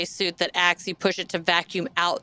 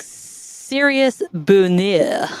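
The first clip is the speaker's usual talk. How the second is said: slow, drawn-out mock French accent; attempted French accent; 4 dB louder, much slower than usual. Shown as a demonstration. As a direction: stretch it out in fake French. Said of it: "doing it in a French accent".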